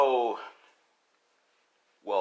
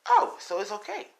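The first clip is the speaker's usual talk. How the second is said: high, louder mock delight; villain girl; 12 semitones higher, 6 dB louder than usual. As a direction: twist the answer in a high playful voice. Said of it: "She's like".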